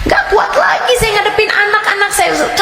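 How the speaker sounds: high, pleading cry of a worn-out mother; plaintive complaint. Acted. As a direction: beg the teacher for relief, voice high. Congregant cries out in complaint. (keluhan jamaah dengan suara tinggi memelas)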